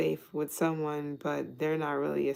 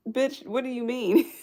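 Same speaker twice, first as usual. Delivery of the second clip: higher and louder, disbelieving snap; incredulous retort; 8 semitones higher, 5 dB louder than usual. Pretend. Reader mock-confronts a guarded partner, incredulous. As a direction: fire it back like they've lost their mind.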